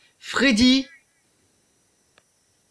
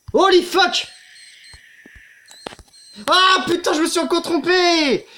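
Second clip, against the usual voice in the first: frustrated cursing; 8 semitones higher than usual. Real, not acted. Jumpscare and mistake, cursing.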